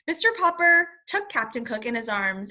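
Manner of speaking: ordinary delivery, nothing marked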